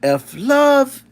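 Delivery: lightly voiced cartoon poet; mimicking Mikey. Streamer opening a mock poem recital as Mikey. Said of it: "I gotta try to mimic Mikey".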